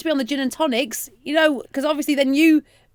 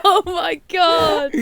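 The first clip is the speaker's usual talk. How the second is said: laughing; 6 semitones higher, 4 dB louder, much slower than usual. Real, not acted. Helpless laughter at own story.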